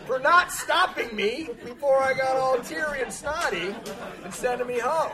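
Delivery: loud, high comic accusation; mock outrage. Sponsee blaming his sponsor for sending him home, mock outraged. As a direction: hurl the blame, hammed-up and loud.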